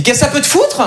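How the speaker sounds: high-pitched, acted indignation; irritated rebuff. Parisian girlfriend rebuffing his hello, irritated. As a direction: snap back at an unwanted greeting.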